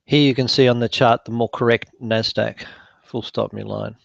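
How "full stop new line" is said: quieter dictation command aside; dictating. Real, not acted. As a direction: drop the voice to dictate punctuation. Dictating punctuation to software.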